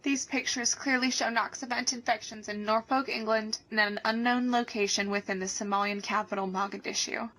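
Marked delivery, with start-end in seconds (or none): none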